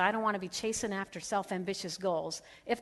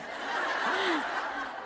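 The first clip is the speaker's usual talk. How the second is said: high breathy giggle; 9 semitones higher than usual. Real, not acted. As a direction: giggle at yourself. Laughing at own story.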